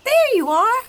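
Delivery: very high, loud donkey voice. A narrator's reading. Donkey approving the cat's singing, high and loud. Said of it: "the donkey nodded".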